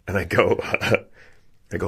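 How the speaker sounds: ordinary talk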